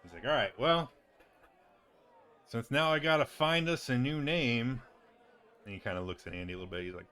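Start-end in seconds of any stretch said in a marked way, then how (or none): 0.2-4.8 exasperated. Acted. Rob grumbles about new name.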